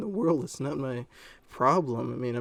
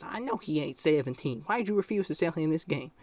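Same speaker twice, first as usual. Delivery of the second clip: mock indignant mother, pitched up; imitated mom; 4 semitones higher than usual. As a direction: put on the complaining mom's voice. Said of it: "this is how she talked as well".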